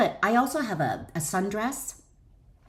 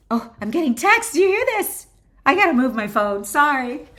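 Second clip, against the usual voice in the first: suddenly louder and higher, flustered by her phone; startled by phone texts; 6 semitones higher, 7 dB louder than usual. Real, not acted. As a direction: caught off guard, apologising while louder. Phone texts interrupt her reading.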